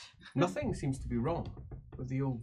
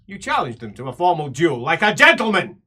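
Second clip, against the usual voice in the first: stern scolding; 6 semitones higher, 13 dB louder than usual. Acted. Emperor lecturing the player on duels, loud and stern.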